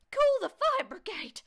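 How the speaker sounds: very high, loud cry for help; sudden shout. A narrator's reading. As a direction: burst into a piercing shout. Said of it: "she shouted suddenly".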